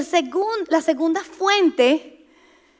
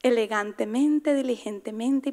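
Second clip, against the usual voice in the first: much softer and tender; gentle demo; 3 semitones lower, 9 dB quieter than usual. Shown as a demonstration. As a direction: speak like a gentleman would. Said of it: "¿Cómo habla un caballero?".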